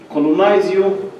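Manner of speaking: ordinary talk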